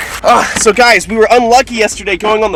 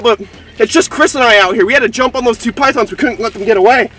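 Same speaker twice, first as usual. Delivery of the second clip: ordinary talk, nothing marked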